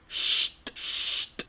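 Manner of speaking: long hushing sh closed by t; sonido alemán ST. Shown as a demonstration. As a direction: draw out "sht", ending on a crisp t. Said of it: "con S T es lo mismo". ("sht", siseo largo y una t)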